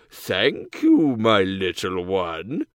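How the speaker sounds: slow, low sly wolf. A narrator's reading. Wolf faking grandma.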